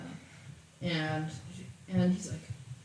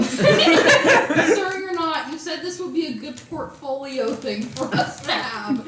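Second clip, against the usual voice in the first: laughing; 7 semitones higher, 6 dB louder, slower than usual. Acted. Retainer talks back to boss, laughing.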